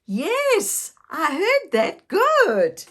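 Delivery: thrilled grandparent praising a bark; excited praise. Real, not acted. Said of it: "Can you bark like a doggy?".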